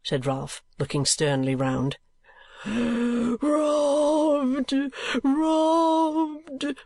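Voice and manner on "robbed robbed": high screamed cry of robbery; screaming. Acted. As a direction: shriek it in panic. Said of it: "screamed Arthur Gride".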